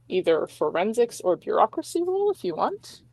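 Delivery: usual speaking voice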